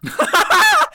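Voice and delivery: loud, very high laugh; burst of laughter. Real, not acted. Telling a friend about a texter, bursting out laughing. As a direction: crack up at the punchline.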